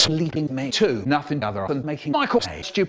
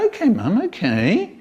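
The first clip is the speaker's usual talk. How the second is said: grudging; 9 semitones higher than usual. Retold or imitated. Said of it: "So we go".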